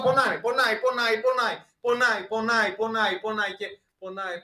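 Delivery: high, loud, hammering repetition; insistent painful repetition. Acted. Preacher showing spouses clashing like stones, high insistent repetition. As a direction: hammer the word out high, easing off at the end.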